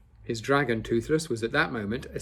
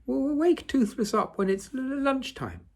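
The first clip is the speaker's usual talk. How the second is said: little dragon voice. Acted. Toothless speaking, stuttering.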